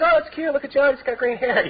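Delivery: high mock-cooing parent. People fussing over green-haired kids today, high mock coo. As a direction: coo it up high like a charmed onlooker. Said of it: "It's like".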